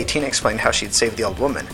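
usual speaking voice

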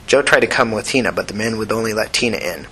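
usual speaking voice

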